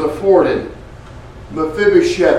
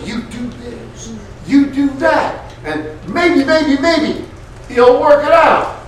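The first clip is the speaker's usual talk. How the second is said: much higher, taunting and unsure; mocking the world; 10 semitones higher than usual. Preacher mocking the world's religion, much higher. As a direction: sneer the world's uncertain offer. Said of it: "the world says".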